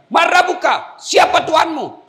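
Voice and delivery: booming high interrogation shout; angel's shouted demand. Acted. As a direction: thunder the question down at the dead man. Angel interrogates the dead man.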